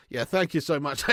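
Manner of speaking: usual speaking voice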